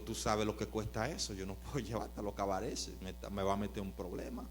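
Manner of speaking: nervous driver speaking softly to a stranger; hushed reply. Retold or imitated. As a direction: say it quietly, almost to yourself. Preacher quoting himself, hushed.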